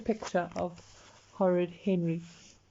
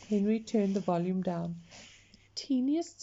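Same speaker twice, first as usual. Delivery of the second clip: usual speaking voice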